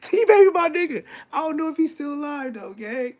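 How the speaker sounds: high, voice squeezed by laughter; real laughing fit. Real, not acted. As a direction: talk through helpless laughter. Cracking up remembering his friend.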